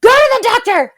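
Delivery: high, loud scolding outburst; urgent retold yelling. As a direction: imitate a friend yelling urgent orders. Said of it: "she was like".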